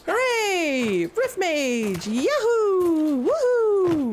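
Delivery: high, drawn-out victory whoops; hammed-up high-pitched celebration; gleeful cheering. Celebrating becoming a Rift Mage.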